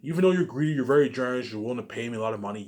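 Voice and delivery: mock actor reply. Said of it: "all gonna be like".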